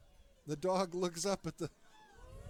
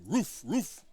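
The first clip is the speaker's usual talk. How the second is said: loud gruff bark of a dog; 6 dB louder than usual. Pretend. Joke teller to partner, barking as the dog.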